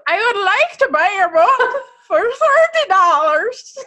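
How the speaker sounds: loud, high, slow hammed-up Irish accent. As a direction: belt out a badly faked Irish lilt. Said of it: "It's Irish".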